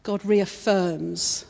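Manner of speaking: normal talking voice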